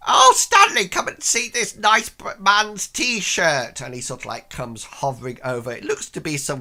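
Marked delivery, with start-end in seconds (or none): 0.1-3.8 put-on very high, loud, slow old lady voice; old woman. Old woman calling Stanley over to see the T-shirt. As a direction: call delightedly to her husband. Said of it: "and then goes".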